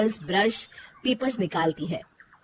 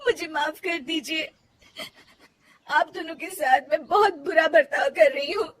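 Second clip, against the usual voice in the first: high, crying plea for forgiveness; tearful apology; 7 semitones higher than usual. Acted. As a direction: apologise through tears.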